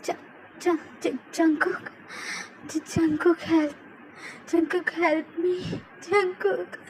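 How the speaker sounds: terrified, barely getting the name out; trapped wife crying for her husband, stammering; weak cry for help. Acted. Tae faintly calls for help.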